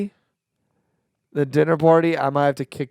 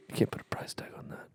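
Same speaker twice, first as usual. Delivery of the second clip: soft, unvoiced whisper; 15 dB quieter, faster than usual. Real, not acted. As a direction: hush it like something precious. Tender whisper about his daughter.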